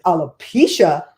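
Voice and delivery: loud, drawn-out disbelief; incredulous echo. Retold or imitated. Caller echoing the company's side effect, incredulous. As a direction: repeat the word slowly and loudly, appalled. Her shocked reply, retold.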